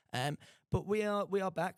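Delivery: ordinary delivery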